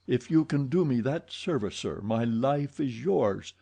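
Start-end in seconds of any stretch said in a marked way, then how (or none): none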